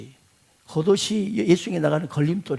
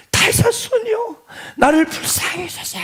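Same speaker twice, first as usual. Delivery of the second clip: calling out; 11 semitones higher than usual. Acted. Beggar calling to Jesus a second time, pleading cry. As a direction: call to Jesus again, pleading. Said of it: "또 다시 부릅니다".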